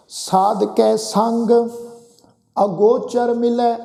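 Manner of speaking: drawn-out, measured chant of the verse; slow scripture recitation. Shown as a demonstration. Preacher reciting Gurbani to the congregation, slow and measured. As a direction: intone each word slowly and reverently. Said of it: "verse repeated in recitation".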